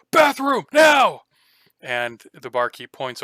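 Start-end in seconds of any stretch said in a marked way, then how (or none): 0.1-1.2 high, booming yell across the room. Acted. Half giant to the barkeep, yelling. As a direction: bellow an urgent demand. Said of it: "yells over at the barkeep".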